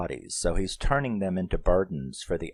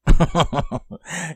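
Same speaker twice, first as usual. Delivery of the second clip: loud, high burst of laughter; real, high-pitched laugh; amused laugh; 10 semitones higher, 8 dB louder than usual. Real, not acted. Amused by the quoted movie line.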